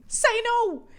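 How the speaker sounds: loud, high burst; emphatic shout. Real, not acted. Host urging people-pleasers to refuse, shouting high. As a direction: shout it out as urgent advice.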